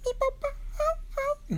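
very high squeaky happy crying; tearful little chick. Acted. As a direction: whimper in a tiny squeaky chick voice. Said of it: "se le hicieron unas lágrimas en los ojos".

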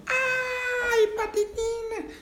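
high frightened cry of her sister's name; scream. Acted. Little sister screaming as her sister flies out. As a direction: shriek it in panic. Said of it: "y Mandarina gritó".

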